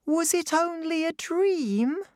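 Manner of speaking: put-on high, bright child voice; little girl voice. A narrator's reading. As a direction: speak as young Clara. Clara speaks.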